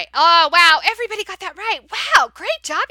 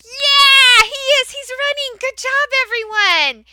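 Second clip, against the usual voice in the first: much higher, squealing, overjoyed; delighted praise; 9 semitones higher, 6 dB louder than usual. Shown as a demonstration. Teacher celebrating the class's right answer, delighted. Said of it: "And they might say".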